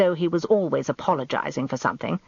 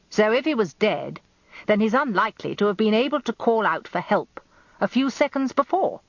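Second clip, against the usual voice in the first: commanding, higher barrister; barrister voice, raised; 6 semitones higher than usual. A narrator's reading. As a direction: press the witness like a sly old barrister. Rumpole presses the point.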